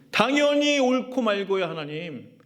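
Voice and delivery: high, insistent, defiant protest; stubborn Jonah. Retold or imitated. Jonah defiantly answering God, high stubborn insistence. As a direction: argue back to God as if you are sure. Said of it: "무슨 말이에요?".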